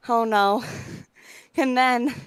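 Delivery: breathless after a rush; panting slightly between words; out of breath. Real, not acted. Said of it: "You sound out of breath".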